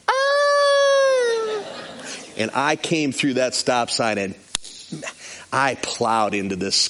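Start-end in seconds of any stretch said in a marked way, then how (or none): 0.1-1.6 long, very high held wail; mock slow-motion scream. Girl seeing the truck coming, mock high scream. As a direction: imitate a drawn-out scream of terror. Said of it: "And she goes".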